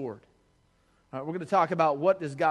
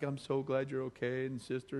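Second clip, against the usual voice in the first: sweet caring side; 4 semitones lower, 9 dB quieter than usual. Retold or imitated. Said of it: "dual personality thing".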